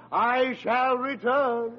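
pompous mock MacArthur pledge; MacArthur quote. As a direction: intone it like a general's vow. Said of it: "Like MacArthur, I said".

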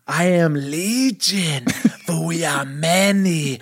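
slow, drawn-out, theatrical demon voice. Acted. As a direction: intone it like a possessed man. The possessed man names himself.

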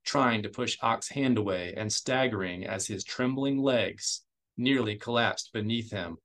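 usual speaking voice

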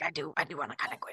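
low, quick, softened aside; hushed, hurried admission; confiding inner voice. Acted. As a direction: voice a guilty inner thought under your breath. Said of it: "Part of me is like".

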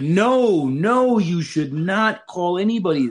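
high, loud, appalled no; shocked refusal. Retold or imitated. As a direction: shriek it in mock outrage. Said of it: "she's like".

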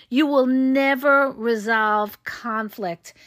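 slow, separated words in "never resolve conflict"; deliberate emphasis. Real, not acted. Coach concluding a warning, slowly stressing "never resolve conflict". Driving the point home.

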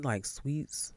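hushed aside; whispering. Real, not acted. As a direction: whisper it as a secret. Whispered aside while eating.

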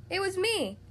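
high, eager little pipe-up; piping up. A narrator's reading. Mister Silly owns up to the king, piping up. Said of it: "piped up Mister Silly".

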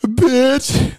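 high, whiny, near-tears "Bitch"; mock crying bitch. Host imitating the caller near tears, high whine. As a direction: whine it like he's about to cry. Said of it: "it seems like he's gonna cry".

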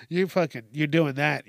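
ordinary talk, nothing marked

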